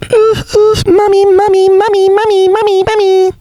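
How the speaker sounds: put-on very high babyish calling for mummy; squeaky baby voice. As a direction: whine like a tiny child wanting mum. Silly baby voice.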